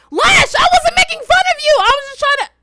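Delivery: very high, loud squealed protest; mock-panicked yell. Pleading with an offended friend, high-pitched mock yell. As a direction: cry out in hammed-up protest.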